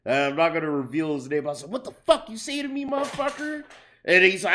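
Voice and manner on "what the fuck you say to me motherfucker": high, quick mock outrage; retold challenge. Cook confronting his boss over an insult, incredulous challenge. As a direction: re-enact an outraged challenge, pitch way up.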